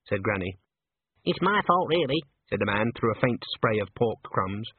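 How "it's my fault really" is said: high, apologetic squeaky man. A narrator's reading. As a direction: squeak out an admission. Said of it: "in a squeaky voice".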